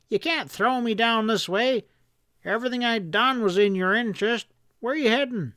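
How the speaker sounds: high pleading whine; whining. A narrator's reading. Stack pleading that he acted for Hanlon, whining high. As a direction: keep whining high and desperate. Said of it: "he was whining".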